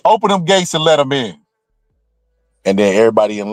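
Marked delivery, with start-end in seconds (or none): 0.1-1.4 higher-pitched, lightly acted God; God's command. God ordering the gatekeepers in a joke, lightly acted command. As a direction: call out a mock divine order. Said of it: "God just said".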